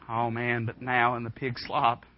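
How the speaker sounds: normal talking voice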